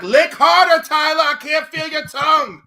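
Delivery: shouting. Pretend. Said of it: "I'll be like".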